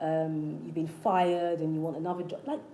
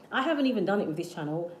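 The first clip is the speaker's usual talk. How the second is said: normal talking voice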